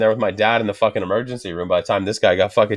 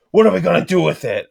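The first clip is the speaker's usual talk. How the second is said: higher, louder baffled dad; a hint of a baffled father; mock dad; 8 semitones higher, 3 dB louder than usual. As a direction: play the puzzled old man. Dad reacts to flying finger.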